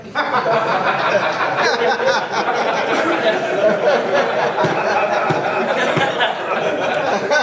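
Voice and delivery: big helpless laugh at the story; laughing at the memory; long real laugh. Real, not acted. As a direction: let a long laugh roll out.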